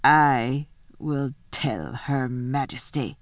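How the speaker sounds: slow, low wolf. Acted. The wolf answers Edmund, slow low wolf voice. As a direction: speak as a menacing wolf, drawn out and low. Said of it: "said the wolf".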